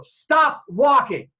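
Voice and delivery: loud, high, slow command; shouted police order. Retold or imitated. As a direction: halt them with a drawn-out mock cop shout. Police order him to halt.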